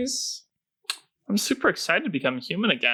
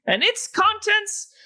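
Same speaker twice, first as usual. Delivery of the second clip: halfling rogue voice; 3 dB louder than usual. Acted. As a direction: pound the table and declare it in a high voice. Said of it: "pounds his little halfling fist on the table and says".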